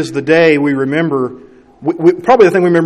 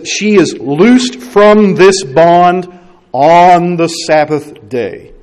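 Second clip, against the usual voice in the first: slow, emphatic, louder reading of the line; emphatic quote; 3 semitones higher, 5 dB louder, much slower than usual. Retold or imitated. Preacher voicing Jesus's words of healing, slow and emphatic. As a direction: lean hard into every word, slowly. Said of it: "He's emphatic".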